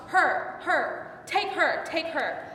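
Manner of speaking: loud, insistent shove of words; urgent command. Acted. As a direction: throw her to them in short, forceful bursts. Old man offers her to mob.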